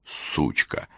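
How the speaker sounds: soft, unvoiced mutter; muttered insult. A narrator's reading. Sam mutters without lifting his head, barely voiced. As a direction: curse under the breath. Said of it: "пробормотал Сэм, не поднимая головы".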